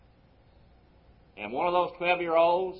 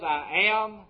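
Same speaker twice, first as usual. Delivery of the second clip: higher, louder "am"; emphatic, raised "I am"; stress on AM; 6 semitones higher, 6 dB louder than usual. Retold or imitated. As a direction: hit "am" firmly as present fact. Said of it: "present tense".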